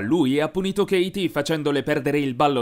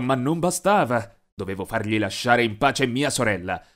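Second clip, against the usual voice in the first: plain reading voice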